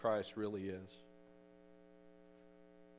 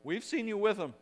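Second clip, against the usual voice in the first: high, louder mock accusation; retold accuser; 10 semitones higher, 5 dB louder than usual. Another person accuses Peter of knowing Jesus, high and loud. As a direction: call him out in a raised, higher voice. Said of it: "another person said".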